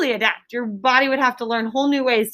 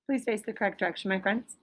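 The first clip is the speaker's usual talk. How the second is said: much softer, quick low reminder; quiet aside; 4 semitones lower, 12 dB quieter than usual. Real, not acted. Teacher quietly redirecting students who turned away, soft and quick. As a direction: slip in a soft correction without stopping the lesson.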